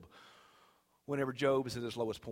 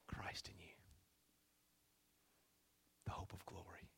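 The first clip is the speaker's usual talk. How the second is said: very soft, low, nearly whispered verse; hushed awe; 6 semitones lower, 19 dB quieter than usual. Real, not acted. Preacher repeating Paul's verse to himself and church, hushed. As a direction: breathe the verse out with reverence.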